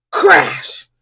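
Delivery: plain reading voice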